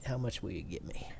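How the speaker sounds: hushed haggle, breathy and fast; whispering. Pretend. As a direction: whisper the price question.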